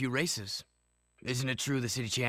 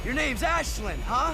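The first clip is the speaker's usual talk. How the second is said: high teasing exclamation; 14 semitones higher, 7 dB louder than usual. Acted. Teasing her name.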